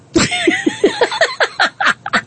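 a burst of high, bright laughter; real amused laugh. Real, not acted. Host laughing at own joke.

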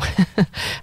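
short breathy giggle; helpless chuckle at yourself; real laugh. Real, not acted. Psychic amused by her own terrible drawing, giggling.

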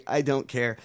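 ordinary talk, nothing marked

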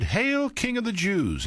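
high, slow mock salute; lightly voiced soldiers' mock greeting; mocking hail. A narrator's reading. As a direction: sneer it as a false homage.

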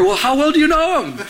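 retold jealous interrogation, high-pitched; mock-suspicious demand, high in the voice. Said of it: "so upset and so jealous".

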